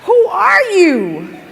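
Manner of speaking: loud, slow, drawn-out protest; upset wail. Retold or imitated. Girl upset at the new management, loud slow wail. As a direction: wail it out like a heartbroken fan.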